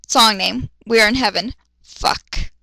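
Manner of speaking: usual speaking voice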